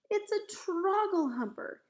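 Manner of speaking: high, loud cry of alarm; horrified shout. A narrator's reading. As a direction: shout it out in dismay. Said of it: "he shouted".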